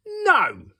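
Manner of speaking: high, sharp little no; curt refusal. Acted. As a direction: snap a short refusal. Wee Willie Winkie refusing.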